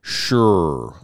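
"Sure" dropped low and flat; a bored, deep one-word yes. Retold or imitated. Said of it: "Didn't Mike Bush always say that".

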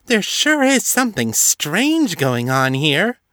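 high, sweet innocent starlet; high-pitched ingenue voice. Acted. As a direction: act the naive schoolgirl. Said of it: "she said in a high-pitched ingenue voice".

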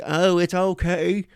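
high, slow, falsetto calm; mock-gentle high voice; creepy soothing doctor. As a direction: coo it like a sinister calm doctor. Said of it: "And he's like".

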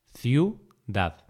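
ciudad split into ciu, dad; syllable by syllable. Shown as a demonstration. Teacher dividing ciudad for learners, syllable by syllable. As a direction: say "ciudad" with a pause between syllables.

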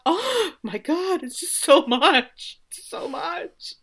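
high, slow, emotional wail; overwhelmed, near tears. Real, not acted. Fan overcome by the team's loss, high tearful wail. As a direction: stretch it out high, falling apart. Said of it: "Pull it together".

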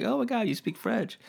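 high imitated wife's gasp; mock impressed wife. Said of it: "she's like".

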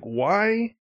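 lightly acted disbelief; retold bewilderment. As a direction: plead it lightly, puzzled. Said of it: "held his arms up like".

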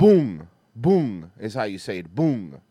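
"Boong" with the vowel of bull; pronunciation demo. Shown as a demonstration. Host reading out how it is pronounced, "Boong" with bull's vowel. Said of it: "like the vowel in bull".

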